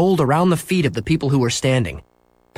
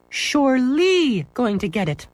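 much higher, slow teacher correcting "Surely"; prim correction; 12 semitones higher, slower than usual. Acted. Apple lady correcting Scooter's grammar, prim. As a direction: stress "Surely" like a fussy teacher. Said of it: "corrected the apple lady".

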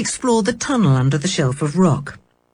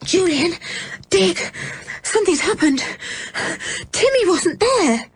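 put-on high, gasping child in alarm; breathless, trembling alarm; 12 semitones higher than usual. A narrator's reading. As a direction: blurt out bad news between breaths. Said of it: "still panting".

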